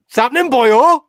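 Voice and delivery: put-on high, loud Welsh accent. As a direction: belt it out with a Welsh lilt. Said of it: "in the Welsh accent".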